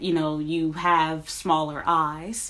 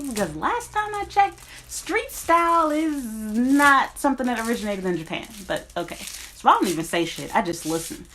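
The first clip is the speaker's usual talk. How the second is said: ordinary talk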